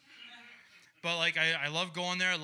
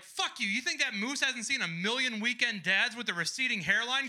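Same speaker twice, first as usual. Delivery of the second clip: mock angry screaming; 7 semitones higher than usual. Comedian imagining telling off the stranger at the zoo, mock screaming. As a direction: tear into him in a high, outraged voice. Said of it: "turn around and scream at this guy".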